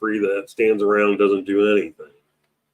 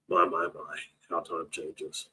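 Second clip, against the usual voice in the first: wistful; 4 semitones lower, 15 dB quieter than usual. Real, not acted. Wistful sigh about old days.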